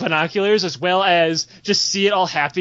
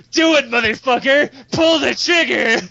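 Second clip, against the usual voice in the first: raised, insistent dare; goading yell; 4 semitones higher, 3 dB louder than usual. Acted. Captive player begging Raph to kill him, goading yell. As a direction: egg him on, stretching the last order. Captive goads his killer, acted.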